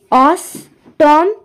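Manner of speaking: অষ্টম stretched very slowly; syllable by syllable. Shown as a demonstration. Teacher counting syllables of অষ্টম, slow. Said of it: "তাহলে কি আমি".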